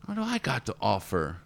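mock the inner critic in a whiny high voice; self-doubting mentee. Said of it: "Reagan Weiss".

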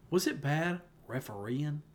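imitating a Southern drawl on "refereeing", said slowly; a light mock Southern "refereeing" with no G; Southern accent. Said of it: "And then you said refereeing".

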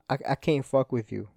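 usual speaking voice

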